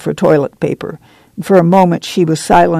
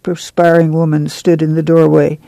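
ordinary delivery

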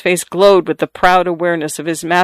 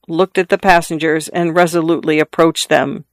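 usual speaking voice

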